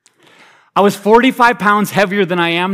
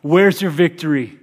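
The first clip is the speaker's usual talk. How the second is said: apostles' trash talk; 5 semitones higher, 5 dB louder than usual. Acted. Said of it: "the apostles' trash talk".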